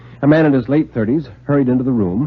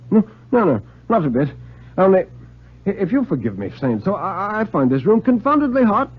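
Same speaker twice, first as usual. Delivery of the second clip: high, rattled denial from the guest; the guest insisting he's fine, pitch jumping up; flustered guest; 9 semitones higher than usual. Acted. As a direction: guest protesting the room is hot, voice jumping up high. Bedford protests he is fine.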